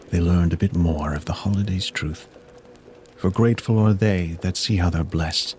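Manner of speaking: usual speaking voice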